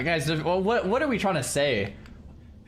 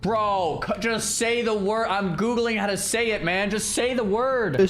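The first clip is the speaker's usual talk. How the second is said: higher, fed-up urging; impatient; 6 semitones higher than usual. Real, not acted. Streamer urging the video narrator, higher and impatient. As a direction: come on, just say it. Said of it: "impatient at video".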